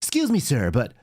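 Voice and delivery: high, slow prissy reporter voice; a light send-up of a reporter; mock reporter.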